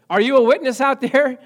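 loud, high rallying shout; calling out to the crowd. Real, not acted. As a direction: rouse the listeners. Preacher calling out to congregation.

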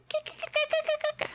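high squirrel chatter, scolding; high clicking squirrel scold; squirrel yelling. Retold or imitated. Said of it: "he was yelling at me".

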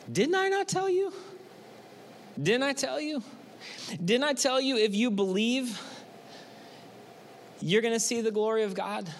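lightly voiced Jesus asking if they forgot. Jesus voiced rebuking followers.

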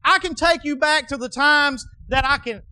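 loud, high preaching. Acted. Preaching at full force.

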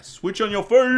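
very high, loud mock Japanese accent. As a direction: shout it as a hammy Japanese ad. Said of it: "That was my Japanese accent".

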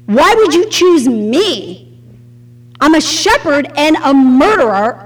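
ordinary talk

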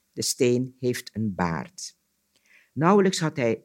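measured storyteller's reading voice; story narration. A narrator's reading. Storyteller reading an Anansi tale aloud, story narration. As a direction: tell it as a folk tale, pausing between sentences.

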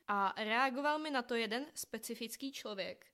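usual speaking voice

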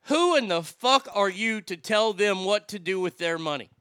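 usual speaking voice

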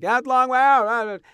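imitated yell. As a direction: call it across the street like a worker. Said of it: "he yells something like".

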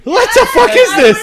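high, loud disbelieving shout; shocked outburst. Real, not acted. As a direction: blurt it out in shock. Shocked at dab-the-tip claim.

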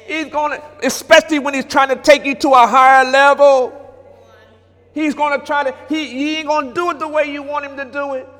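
rising, quickening preacher's cadence; preaching. Acted. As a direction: drive the point home like a pulpit preacher. Preaching builds, higher and faster.

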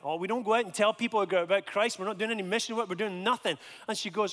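usual speaking voice

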